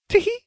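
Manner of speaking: very high "Tee-hee" squeaked with a rise; airheaded high-pitched giggle; silly giggle. A narrator's reading. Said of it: "Farfelu giggled".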